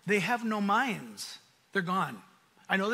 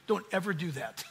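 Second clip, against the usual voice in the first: usual speaking voice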